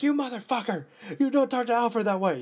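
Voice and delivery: high, loud outraged yell; yelling at the screen. Retold or imitated. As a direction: shout it up high, tearful and indignant. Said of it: "like, yelling at the screen".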